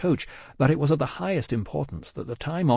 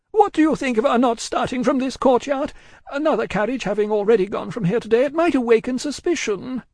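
high, urgent woman's voice; agitated woman; 12 semitones higher, 4 dB louder than usual. A narrator's reading. Miss Pross consulting Cruncher about the courtyard, agitated. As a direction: raise the pitch right up in worry. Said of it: "agitation was so great that she could hardly speak".

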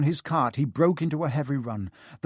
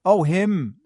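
flustered; 5 semitones higher, 6 dB louder than usual. A narrator's reading. Harry flustered.

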